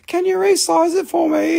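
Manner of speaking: high-pitched mock customer voice; mimicked customer request. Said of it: "And then they ask me".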